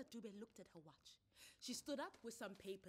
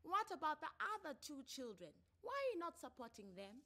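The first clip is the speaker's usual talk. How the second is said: raised, firm official voice; counselor's questions; 8 semitones higher, 7 dB louder than usual. Acted. Counselor questioning a father who stopped paying, firm. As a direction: press him like a family court counselor.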